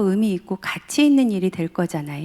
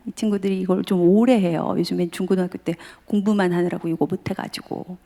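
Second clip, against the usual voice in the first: ordinary talk, nothing marked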